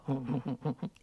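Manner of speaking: low, quiet sly chuckle. Acted. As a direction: chuckle slyly to yourself.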